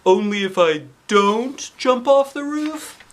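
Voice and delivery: high, slow puzzled principal voice; drawn-out disbelief, lightly voiced principal. A narrator's reading. Principal repeating the kids' condition, puzzled. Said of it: "looking puzzled".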